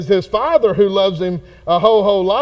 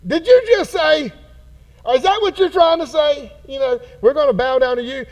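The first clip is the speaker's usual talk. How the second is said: higher, indignant mock disbelief; sarcastic brothers; 7 semitones higher than usual. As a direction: scoff at him like the jealous brothers. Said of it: "they're going".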